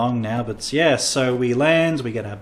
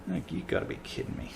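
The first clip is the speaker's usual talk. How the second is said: whispered disbelief; 6 semitones higher, 12 dB quieter than usual. Retold or imitated. Imagined officer thought.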